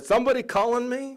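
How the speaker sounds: high comic impression of an arriving friend; imitating Phil Rizzuto. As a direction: pop in high and chirpy as if on cue. Retold Rizzuto quote.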